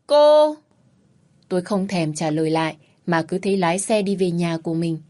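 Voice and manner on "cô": sharp, loud, high cry of outrage; outraged shout. Acted. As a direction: bark one furious word at her. Man shouts after her.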